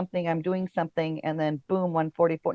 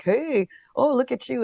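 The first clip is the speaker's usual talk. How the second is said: higher-pitched imitation of a come-on; teasing mock pickup voice; mock flirty guys; 7 semitones higher than usual.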